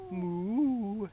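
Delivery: put-on playful mooing for a baby; cow moo.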